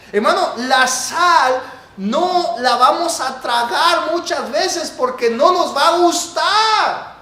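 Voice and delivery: slow, loud, high preaching shout; fiery preaching. Acted. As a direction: hammer each word home from the pulpit. Preaching at full force.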